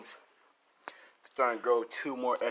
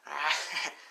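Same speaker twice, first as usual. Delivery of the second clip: short breathy acted laugh; acted taunting chuckle; villain's laughing; 3 semitones higher than usual. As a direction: laugh mockingly at the hero. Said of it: "like, laughing".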